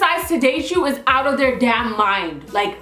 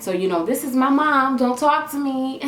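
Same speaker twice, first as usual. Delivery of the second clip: ordinary talk, nothing marked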